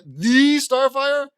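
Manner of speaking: loud, high, slow gasp of delight; a fan stunned to meet her hero; starstruck fan. Acted. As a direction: squeal it out like meeting your idol. Fan meets favorite superhero.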